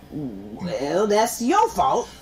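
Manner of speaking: slow, low-pitched "well", then drawn-out blame; dismissive. Acted. Podcaster brushing off people who take offense, dismissive. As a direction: shrug it off slowly.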